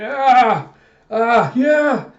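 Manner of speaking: loud, high horrified scream. Retold or imitated. Man sees Alita and screams in horror, loud high shriek. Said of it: "he just screams out".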